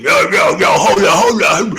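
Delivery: loud mocking shout of "hold on"; hammed-up imitation of a shouting interrupter; mock screaming. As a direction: parody someone barging in yelling. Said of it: "trying to come screaming".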